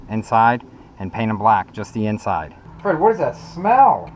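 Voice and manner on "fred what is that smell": slow, high, grossed out; disgusted by smell. Real, not acted. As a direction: recoil at the stink. Complains of bad smell.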